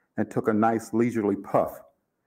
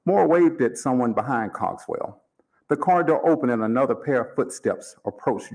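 plain reading voice

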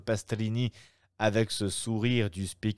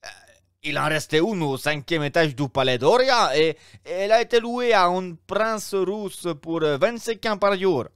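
higher, louder obsequious innkeeper voice; innkeeper, fussy; 8 semitones higher, 6 dB louder than usual. Acted. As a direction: play the eager Roman host, pitched up. (aubergiste empressé, voix plus aiguë et plus forte)

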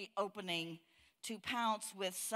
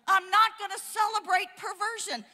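fierce declaration; 12 semitones higher, 12 dB louder than usual. Real, not acted. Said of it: "they celebrate their perversion".

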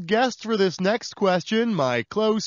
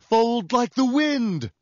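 slow dramatic; 4 semitones higher, slower than usual. Acted. Dramatic host catchphrase.

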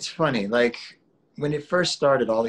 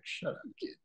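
hushed mock "shut up" to a kid, breathed out; mock retort; 9 semitones higher, 12 dB quieter than usual. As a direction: tease the kid under your breath. Retorting to his son.

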